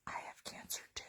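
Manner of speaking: soft confiding whisper. Retold or imitated. Coworker whispering his own diagnosis, hushed. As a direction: breathe it out as a secret. Kid whispers back later.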